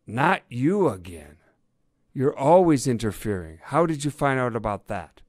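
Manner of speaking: exasperated complaint. A narrator's reading. Inspector fed up with an interfering reporter, exasperated. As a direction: berate a meddler, voice up.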